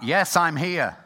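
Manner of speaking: voice of God. Retold or imitated. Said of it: "the voice came back".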